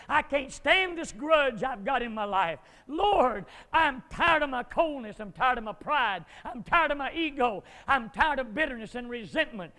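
loud, fervent revival preaching; fiery preaching. Acted. Preacher urging the congregation toward revival, fiery preaching.

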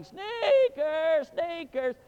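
high, loud drawn-out call; shouting for the dog out of the car; yelling the dog's name. Retold or imitated. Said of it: "I'm going all over town yelling".

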